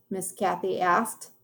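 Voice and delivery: usual speaking voice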